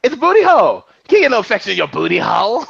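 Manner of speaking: high, loud, fast brassy comedian voice; Tiffany Haddish. Retold or imitated. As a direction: belt it out like a brash comedian. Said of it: "Tiffany Haddish".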